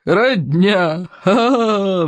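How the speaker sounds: hooting mockery breaking into acted guffaws; jeering berserkers in fits of laughter; roaring laughter. A narrator's reading. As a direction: crow "Родня", then laugh high and long. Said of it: "взревели берсерки".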